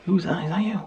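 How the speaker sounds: soft high pet voice; high pet talk. Real, not acted. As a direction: coo up high at the dog. Talking to the pet.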